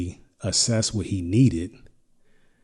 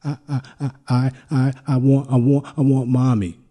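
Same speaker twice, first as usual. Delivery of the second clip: put-on high, loud wailing child's stammer; imitating crying child; 5 semitones higher, 8 dB louder than usual. Little son wailing for his mommy at night, high and loud. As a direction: wail it like a sobbing little boy. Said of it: "And he's like".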